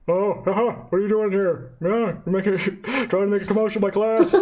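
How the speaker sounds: high, loud, chuckling teacher voice; Robin Williams-style teacher. Acted. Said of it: "similar to, uh, Robin Williams".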